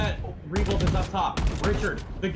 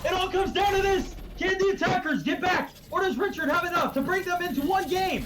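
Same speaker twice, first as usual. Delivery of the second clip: excited caster; 8 semitones higher than usual. Real, not acted. Clutch round hype call.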